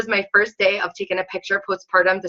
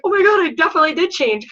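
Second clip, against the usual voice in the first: near tears; 8 semitones higher than usual. Retold or imitated. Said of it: "I almost started crying".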